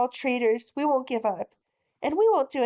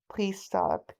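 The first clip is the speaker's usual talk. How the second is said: normal talking voice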